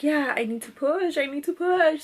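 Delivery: mildly urgent re-enacted plea; retold urgency. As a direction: act out pressing need, building on the repeat. Labouring mother insists on pushing.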